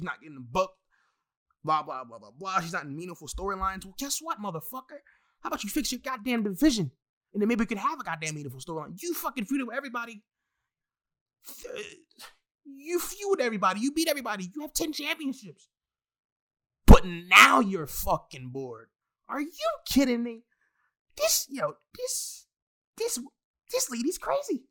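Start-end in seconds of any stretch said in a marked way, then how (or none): 3.8-15.7 pitched-up, incredulous venting at her; exasperated rant. Real, not acted. Fan venting at Charlotte's complaints, pitched-up exasperated rant. 16.9-24.6 loud, high disbelieving blowup; incredulous outburst. Real, not acted. Fan scoffing at Charlotte being bored, loud incredulous blowup.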